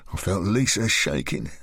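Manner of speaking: ordinary delivery, nothing marked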